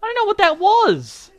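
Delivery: high, loud squeal of bafflement; loud falsetto disbelief; baffled outburst. Real, not acted. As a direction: cry out in bewildered self-mockery. Baffled by own failed accent.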